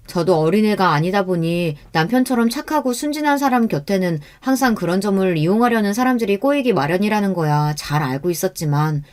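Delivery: ordinary delivery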